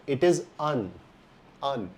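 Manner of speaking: "un" said as a short uh-n, not ann. Shown as a demonstration. Teacher explaining the article an, short un. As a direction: clip the article down to un. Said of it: "यही rule apply होता है".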